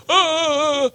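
high strained shout of refusal; ugly mock yell. A hard-hearted listener refusing the gospel, mock yell. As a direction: yell like someone refusing to hear. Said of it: "they go".

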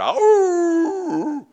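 long, high, loud howl; imitated wolf's "awoo", held on a high note. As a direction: howl like a wolf out in the dark. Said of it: "there's a wolf out there".

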